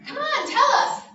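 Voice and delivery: high, loud pleading push; eager crowd. Acted. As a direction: villagers begging for the news. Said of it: "they all said".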